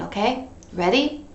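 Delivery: usual speaking voice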